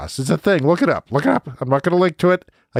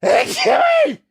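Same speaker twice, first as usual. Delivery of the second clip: big explosive sneeze, real; slower than usual. Real, not acted. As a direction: sneeze forced out by pepper up the nose. Third pepper sneeze.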